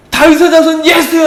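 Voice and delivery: high, loud unrestrained shout; crying out. Acted. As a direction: bellow desperately, unable to hold back. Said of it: "하면서 막 부르짖는 거예요". (참지 못하고 크게 부르짖듯이)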